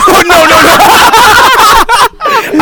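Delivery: high, loud shriek of laughter; laughing denial. Real, not acted. Denying drinks with friend's dad, laughing hard. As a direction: protest helplessly through big laughter.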